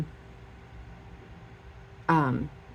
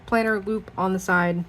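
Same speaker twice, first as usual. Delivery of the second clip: ordinary delivery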